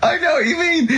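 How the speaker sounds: high laughing agreement; laughing and near tears. Real, not acted. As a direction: agree while cracking up.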